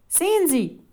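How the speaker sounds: high, loud triumphant call; Rufus calling out. A narrator's reading. Boy pointing out the next room, calling out. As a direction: call the others over excitedly. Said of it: "rief Rufus".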